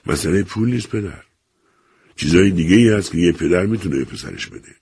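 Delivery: ordinary delivery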